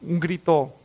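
normal talking voice

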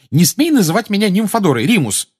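put-on high, loud indignant young witch; indignant outburst. A narrator's reading. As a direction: flare up at him, pitched high and loud. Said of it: "вскинулась молодая волшебница".

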